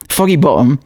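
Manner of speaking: playful put-on Cockney; Cockney accent. Shown as a demonstration. Host showing a funny place name, in Cockney. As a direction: say it like a mock Cockney. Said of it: "we'll say in a Cockney accent".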